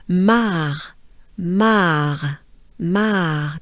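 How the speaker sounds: mar with the R just a breath in the throat; French R. Shown as a demonstration. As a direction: let the end of mar fade into a breathed French R. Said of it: "Just a breath".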